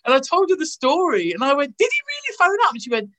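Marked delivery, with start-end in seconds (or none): 1.8-2.7 surprised. Retold or imitated. Disbelief at the news.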